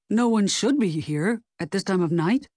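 a man's voice with mild panic; a touch of alarm in an altered voice; laced with panic. A narrator's reading. Man caught late at night with a woman, laced with panic. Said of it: "laced with panic".

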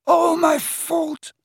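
high, slow, tearful sobbing. A narrator's reading. Old man sobbing to Harry in guilt. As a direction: sob it out in broken guilt. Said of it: "he sobbed".

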